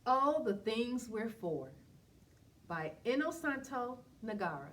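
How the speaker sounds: lively rhythmic read-aloud of a rhyming picture book; reading to children. Acted. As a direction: read it out warmly to a room of kids, bouncing the rhymes.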